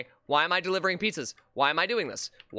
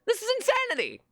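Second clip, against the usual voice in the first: very high-pitched outburst; over-the-top gamer despair; exasperated cry. Real, not acted. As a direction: cry out in disbelief, voice shooting up. Exasperated at the game.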